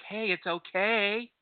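hammed-up sweet-talking ad voice; mocking recruiter. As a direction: sneer through a gushing parody sales pitch. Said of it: "advertise it like".

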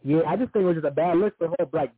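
normal talking voice

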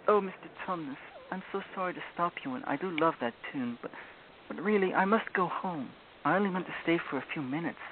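put-on young girl pleading politely; high, earnest little girl; Lucy voice. A narrator's reading. Reads Lucy aloud.

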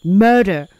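loud acted cry of alarm; sudden loud outcry in a story; yelled. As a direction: yell it out like a frightened patient. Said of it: "Another one yelled".

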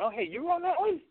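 surprised. Retold or imitated. Buddies greeting after rival films.

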